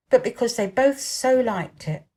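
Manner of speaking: usual speaking voice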